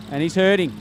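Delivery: ordinary talk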